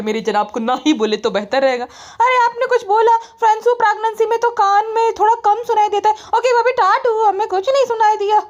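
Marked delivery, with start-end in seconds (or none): 2.2-8.4 high mock-innocent teasing; mock innocent brothers-in-law. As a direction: play dumb in a squeaky voice. Brothers-in-law pretending not to hear.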